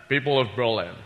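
famous actor impression delivering a presidential speech; Sean Connery. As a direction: play a film star playing the president. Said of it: "Sean Connery doing, uh, President Kennedy".

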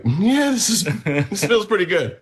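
high pleased voice. Retold or imitated. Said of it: "we're like".

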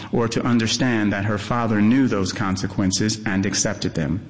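ordinary delivery, nothing marked

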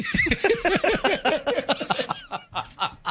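drawn-out high-pitched laughter; long high laugh. Real, not acted. Host laughs at own guess.

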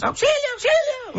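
high mock cry for help. Host jokes that coworkers cry for help, high mock yell. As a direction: squeal it like coworkers in a panic. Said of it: "te gritan todos".

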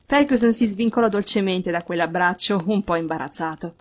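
plain reading voice

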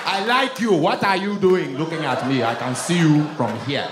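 put-on loud, bossy Nigerian accent calling to a woman; lightly voiced Nigerian flirt; Nigerian man. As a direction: boom it across the street in a Nigerian accent. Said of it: "they would talk to women crazy".

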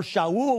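"Shaul" said the Hebrew way, sha-OOL; Hebrew pronunciation. Shown as a demonstration. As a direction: say "Shaul" in two syllables, opening on sh, stressed on the end. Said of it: "we would pronounce it in Hebrew".

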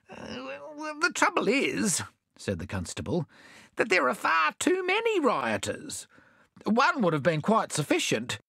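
very high, nervous excuses; timid constable. A narrator's reading. The constable makes excuses to the mayor, high and timid. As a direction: wriggle out of the job.

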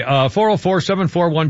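usual speaking voice

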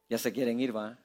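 low, hushed aside; low teasing aside. Real, not acted. Preacher to restless congregation, low quiet aside. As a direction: say it softly, dropped right down.

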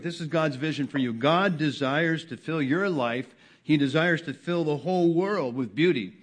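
loud, high-pitched preaching; sermon voice swelling with conviction; preaching at full force. Acted. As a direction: rise up and proclaim the vision.

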